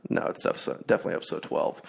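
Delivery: fast unvoiced mutter under the breath; a quick self-correction muttered aside; muttered to himself. Real, not acted. Host muttering to himself about the episode count, fast and unvoiced.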